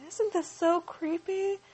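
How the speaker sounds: high slow mock Zelda. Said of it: "Zelda's gotta say something".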